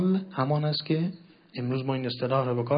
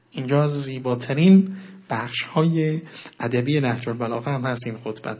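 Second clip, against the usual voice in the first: ordinary talk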